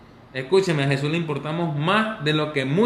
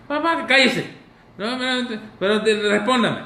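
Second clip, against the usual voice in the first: lightly voiced cheeky son, higher; mock talking-back boy; 7 semitones higher, 3 dB louder than usual. Boy talking back to his scolding father, light mock cheek. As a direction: talk back to dad playfully. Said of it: "un muchacho regañándolo".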